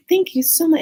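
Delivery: high, loud, effusive thanks; grateful lady. Retold or imitated. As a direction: gush like a relieved neighbor. Retold lady thanking at the stairs.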